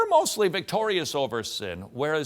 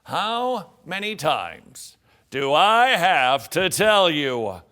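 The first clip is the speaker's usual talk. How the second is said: exasperated parent; 8 dB louder, slower than usual. Acted. Exasperated parent scolding kids.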